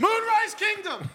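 high, drawn-out mock holler; slow, high-pitched pretend outburst; mock heckle. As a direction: call it out like an interrupting heckler. Acting the interrupting audience heckler.

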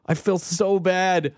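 slow, drawn-out sympathetic wince; pained sympathy. Acted. Moviegoer pitying everyone in the endless credits, pained sympathy.

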